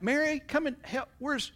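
high-pitched call to her sister from the kitchen; high calling Martha. Acted. Martha calling her sister to help, high and impatient.

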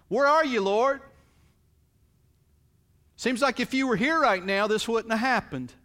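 loud, high grieving accusation; anguished cry. Acted. A grieving believer crying out to God, loud and anguished.

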